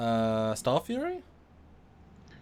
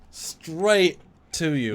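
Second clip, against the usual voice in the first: "straight" drawn out, high and loud; emphatic stress; 5 semitones higher, 6 dB louder, much slower than usual. Retold or imitated. Co-host tells how a fan walks up, "straight" stretched emphatically.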